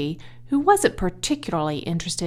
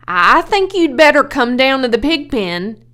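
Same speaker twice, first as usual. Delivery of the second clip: higher, louder urgent call; 5 semitones higher, 10 dB louder than usual. Acted. Lurvy tells Mr. Zuckerman to come, urgent and loud. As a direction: hurry him down to the pen. Farmhand urgently calling boss.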